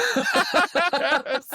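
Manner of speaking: high burst of disbelieving laughter; incredulous laugh. Real, not acted. Scoffing at the ticket price.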